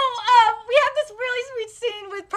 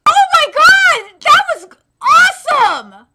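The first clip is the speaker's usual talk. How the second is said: loud, drawn-out thrilled yell; excited shout; 4 semitones higher, 10 dB louder than usual. Real, not acted. Fan cheering a great fight scene, loud thrilled yell. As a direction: burst out with delight.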